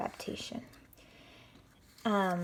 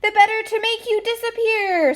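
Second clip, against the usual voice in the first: much higher, louder menacing monster voice; monster's scream; 13 semitones higher, 7 dB louder than usual. Acted. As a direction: shriek the threat like a villain. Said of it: "screamed the six-foot monster".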